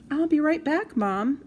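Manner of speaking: high, loud call to another room; yelled. Acted. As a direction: shout it out to Mom far away. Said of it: "I yelled".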